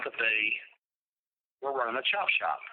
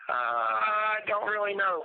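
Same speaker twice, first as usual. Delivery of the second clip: slow, high, drawn-out hesitation; retold stalling, pitched up and slow; acted-out uncertainty; 9 semitones higher, slower than usual. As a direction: stretch it out as if stumped. Acting out his stunned reply.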